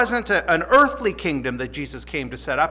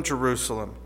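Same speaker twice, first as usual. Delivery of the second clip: Jerusalem dropped lower and much softer, like a sigh; softer, lower echo; 6 semitones lower, 7 dB quieter than usual. Acted. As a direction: let the second Jerusalem fall away softly in sorrow. Lament repeats the city's name.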